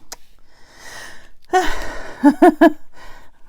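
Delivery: a long loud breath out; real puff of breath while crafting; heavy puffing sigh. Real, not acted. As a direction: let out a big puff of air. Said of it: "I can hear myself puffing".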